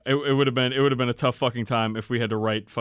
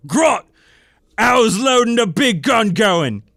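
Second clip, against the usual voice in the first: loud, higher orc roar, slowing; guttural rough orc roar; 10 semitones higher, 8 dB louder, slower than usual. Acted. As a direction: roar at the little goblin aide. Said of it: "he roared".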